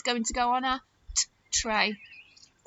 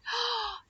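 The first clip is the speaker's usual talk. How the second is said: amazed mock gasp for children; sharp intake of breath, playfully impressed; 10 semitones higher, 5 dB quieter than usual. Teacher amazed at a long word, gasping.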